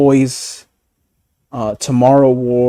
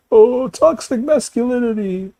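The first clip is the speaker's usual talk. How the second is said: high whiny mock voice, much higher; mocking whiny critic; 11 semitones higher than usual. Mocking critics who cancel shows, a high whiny voice. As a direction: sneer it like a whining critic.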